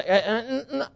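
half-laughing, mock eager to cut in; impatient interrupting. Husband itching to interrupt his wife, mock mm-mm. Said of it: "You wanna say".

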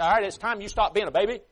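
mildly scolding, patience run out; exasperated God. Acted. God to his people, exasperated as with a child. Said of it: "Do you think God ever looks at us and says".

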